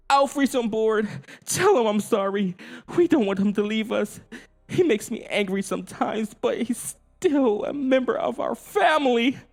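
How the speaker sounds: a hint of a pleading dad; lightly voiced father. A narrator's reading. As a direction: plead for the family. Willie's voice in the story.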